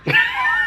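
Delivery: short high burst of laughter; disbelieving laugh; real incredulous laugh. Real, not acted. Laughs after naming the offense.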